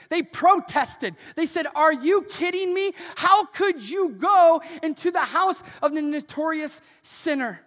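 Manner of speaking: high, loud, fast insistent promise; loud rapid sermon proclamation; preaching at full force. Acted. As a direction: drive the promise home hard.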